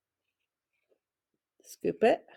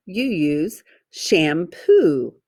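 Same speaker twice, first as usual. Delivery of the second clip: ordinary talk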